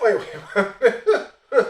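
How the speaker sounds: breaking into laughter on each wait; laughing disbelief. Real, not acted. The storyteller cracking up at the Frenchman, laughing. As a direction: wait it out through real laughs.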